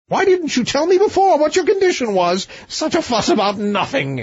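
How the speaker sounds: high, impatient king voice; the king throwing up his hands at the fuss; exasperated king. A narrator's reading. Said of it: "exclaimed the king".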